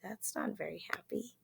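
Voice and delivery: soft gasped whisper; whispered aside. Real, not acted. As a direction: drop to a hushed aside.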